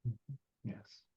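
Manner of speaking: ordinary talk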